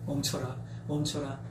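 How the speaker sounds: low, soft order to halt; hushed command. Acted. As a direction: quietly hold them back. God's restraining command, acted.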